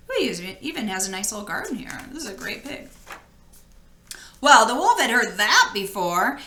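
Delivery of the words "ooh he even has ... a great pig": much softer, quick admiring aside. Real, not acted. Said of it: "aside to listeners".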